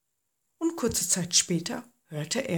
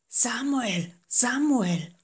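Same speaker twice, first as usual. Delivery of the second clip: lightly voiced, otherworldly call; a hint of a distant voice; mysterious calling voice; 5 semitones higher, slower than usual. A narrator's reading. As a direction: call him gently from the dark. The voice calls Samuel again.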